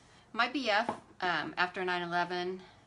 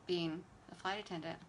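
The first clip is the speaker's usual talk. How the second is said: ordinary talk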